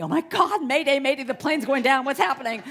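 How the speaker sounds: loud, high hammed-up alarm; mock panic. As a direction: imitate passengers convinced the plane is crashing. Imitating passengers' panicked thoughts.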